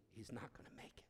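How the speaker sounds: real hushed whisper, breath only. Real, not acted. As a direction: drop to a whisper of despair. Grave plea to the ladies.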